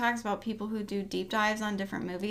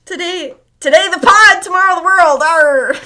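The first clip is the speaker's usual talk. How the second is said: loud, high pirate boast; 5 semitones higher, 14 dB louder than usual. Pretend. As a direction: roar it like a pirate captain. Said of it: "Talk Like a Pirate Day".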